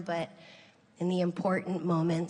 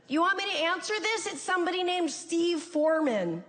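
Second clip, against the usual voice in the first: higher, fast, a touch incredulous; son-in-law reading the caller ID, higher and quick; Rory quoted; 10 semitones higher than usual. Retold or imitated. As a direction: rattle it off surprised at the caller. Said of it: "Rory's like".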